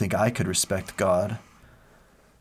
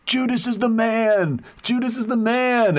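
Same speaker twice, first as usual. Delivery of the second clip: exuberant cheering; 5 dB louder than usual. Retold or imitated. Tribe cheering the villain of the missionary's story, exuberant. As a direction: whoop it like a crazed, celebrating crowd. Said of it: "they're all exuberant and excited".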